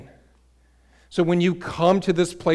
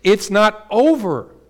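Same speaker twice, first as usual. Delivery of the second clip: slow, loud, lifted declaration; excited; 5 semitones higher, 7 dB louder, slower than usual. Real, not acted. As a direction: proclaim it with real excitement. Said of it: "I'm just getting excited".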